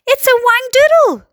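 high-pitched, loud exclamation; alarmed yell. A narrator's reading. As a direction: yell out the monster's name. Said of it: "yelled the head of fire department".

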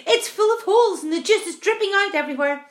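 high, loud and urgent; alarmed shout. A narrator's reading. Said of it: "shouted the old green grasshopper".

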